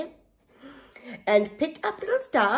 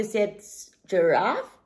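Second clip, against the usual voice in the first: plain reading voice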